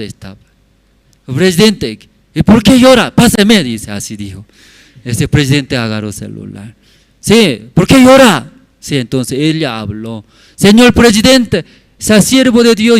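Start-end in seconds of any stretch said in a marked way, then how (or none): 1.2-3.6 high, loud acted demand; retold outburst, pitched well up; urgent demand. Acts president demanding the phone. 7.2-8.5 slow, high, loud acted question; retold loud questioning, pitched up; insistent question. Acts president asking on phone. 10.6-13.0 high, loud acted plea; retold petition at raised pitch and volume; impassioned appeal. Acts woman pleading to president.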